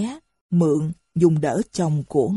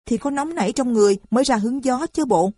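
higher, fast and sassy; talking back; 7 semitones higher, faster than usual. A narrator's reading. Lan sassing the old mother back, fast and higher. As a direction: answer back with a saucy edge. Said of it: "Lan trả treo".

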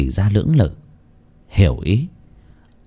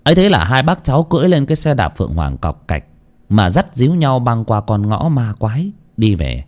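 usual speaking voice

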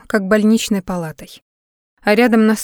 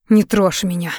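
hissed. A narrator's reading. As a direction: hiss it to push him away. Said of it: "прошипела девушка". (сердито шипя)

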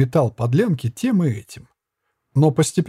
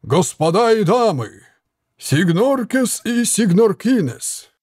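higher, slow fairground call; barker voice; 7 semitones higher, slower than usual. A narrator's reading. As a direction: call out to the crowd. Puppeteer barker calling crowd.

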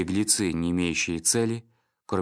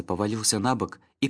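ordinary delivery, nothing marked

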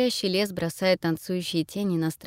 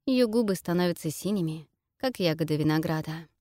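usual speaking voice

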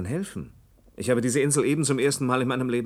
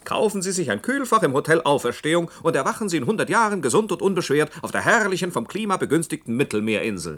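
hammed-up salesman pitch, much higher, laughing into it; mock advert voice; 6 semitones higher than usual. Mocking the old cold-storage sales pitch to the islander, in a higher ad voice. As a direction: parody a cheesy real-estate ad, starting on a laugh.

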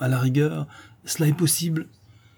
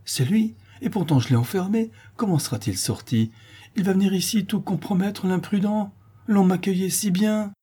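much higher, panicked lamenting; alarmed lament; 8 semitones higher than usual. A narrator's reading. Tenant frightened his dog will ruin his welcome, higher panicked lament. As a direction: wail in dismay that all is lost. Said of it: "effaré, désolé, se lamentait ainsi".

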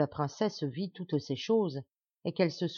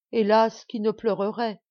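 a louder, higher lament; plaintive; 4 semitones higher, 6 dB louder than usual. Acted. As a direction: sigh it out like a wronged princess. Princess laments. (princesse plaintive)

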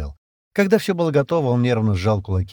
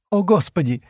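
higher, startled gasp of words; frightened exclamation; 9 semitones higher, 3 dB louder than usual. A narrator's reading. Шира sees the hunter's teeth, frightened cry. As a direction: recoil in fright. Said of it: "Шира испуганно дёрнулась".

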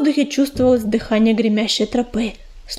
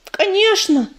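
talking through clenched teeth; mouth full; 5 semitones higher, slower than usual. Acted. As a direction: mumble it with a mouthful. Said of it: "не разжимая зубов, промычал".

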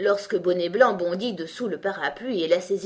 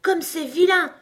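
high, loud indignant outburst; indignant exclamation; 11 semitones higher, 7 dB louder than usual. A narrator's reading. Alice judging the Walrus's behaviour, indignant. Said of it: "s'exclama Alice indignée".

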